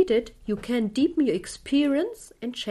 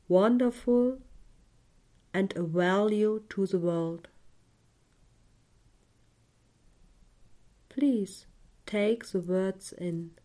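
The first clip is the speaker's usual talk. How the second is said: guided meditation. Acted. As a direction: guide the listener gently into calm.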